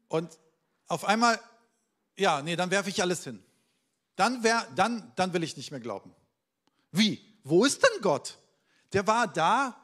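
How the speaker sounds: worked-up questioning of God; agitated doubter. Acted. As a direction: let the doubt burst out. Voicing a doubter losing faith.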